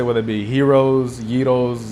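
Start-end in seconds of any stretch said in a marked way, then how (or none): 0.5-1.2 gyros said as HEE-rohz; alternative pronunciation. Shown as a demonstration. Listing ways to pronounce Greek food, gyros as HEE-rohz. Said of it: "how you wanna pronounce it". 1.2-1.9 gyros said as YEE-rohz; alternative pronunciation. Shown as a demonstration. Listing ways to pronounce Greek food, gyros as YEE-rohz. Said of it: "how you wanna pronounce it".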